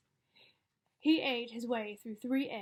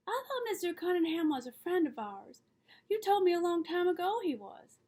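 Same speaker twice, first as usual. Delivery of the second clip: put-on higher-pitched child questioning a parent; puzzled child; 7 semitones higher, 4 dB louder than usual. A narrator's reading. Child challenging father about a friend, higher and puzzled. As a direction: lift the voice into a child's protest.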